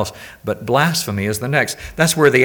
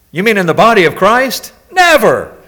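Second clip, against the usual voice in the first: high, loud mock disbelief; hammed-up outrage at the very idea; mock shock; 9 semitones higher, 9 dB louder than usual. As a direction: gasp it out as if scandalized.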